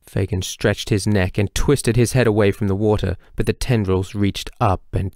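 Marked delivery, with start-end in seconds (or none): none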